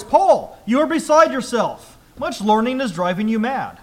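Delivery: loud, high cry on the name, then easing. Acted. Festus breaking in on Paul's defense, loud voice. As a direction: call out loudly, starting high and settling by the end. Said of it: "Festus said with a loud voice".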